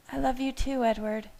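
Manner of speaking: high, sighing woman's voice. A narrator's reading. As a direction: sigh it lovingly. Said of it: "she sighed".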